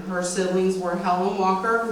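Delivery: usual speaking voice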